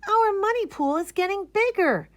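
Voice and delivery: put-on high, thrilled kid voice; delighted at the growing pile; excited exclaim. A narrator's reading. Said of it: "the kids exclaim".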